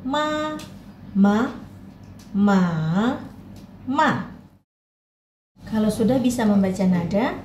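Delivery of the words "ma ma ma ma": ma through all four tones. Shown as a demonstration. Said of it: "Coba diulang sendiri".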